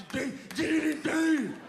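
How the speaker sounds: high, loud ding-ding noises; imitated bell noise. Comic mimicking video game bells, high loud dings. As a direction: ring it out like a game machine.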